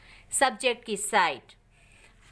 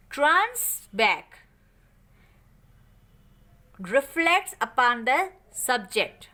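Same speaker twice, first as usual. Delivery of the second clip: slow dictation; much slower than usual. Shown as a demonstration. Slowly dictates the English definition.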